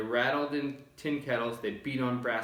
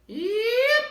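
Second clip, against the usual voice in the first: piercing very high, loud yip; tiny shout; 10 dB louder than usual. A narrator's reading. Small boy shouting his one word, very high and loud. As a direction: shout it with all his might. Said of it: "and he shouted".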